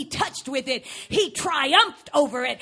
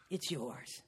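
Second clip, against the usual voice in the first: much lower, much softer surrender; defeated murmur; 16 dB quieter than usual. Retold or imitated. Losing player quietly giving up the pot. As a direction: give it up, beaten. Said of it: "pushed him forward and went".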